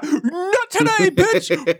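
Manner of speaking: high, slow, laughing battle cry; hero kid battle cry. Retold or imitated. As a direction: brave kid yelling at the doll. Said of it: "freezes and he's like".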